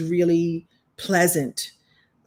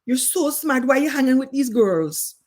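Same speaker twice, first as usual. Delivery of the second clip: higher, pleading Caribbean reproach; Caribbean accent; 6 semitones higher than usual. Retold or imitated. Teacher questions pupil about her friends, Caribbean accent. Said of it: "I'm gonna do an all-purpose Caribbean accent".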